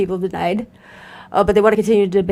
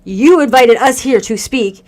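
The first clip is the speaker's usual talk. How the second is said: indignant; 4 semitones higher, 6 dB louder, slower than usual. Retold or imitated. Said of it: "she interrupted".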